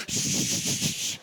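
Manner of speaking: unvoiced shh; shush demo. Shown as a demonstration. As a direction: breathe out a long shush. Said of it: "Everybody else go".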